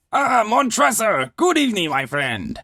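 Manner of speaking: boozy high-pitched cheer; tipsy Fortunato. Acted. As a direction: hail your friend like a merry drunk. Fortunato greets narrator, tipsy.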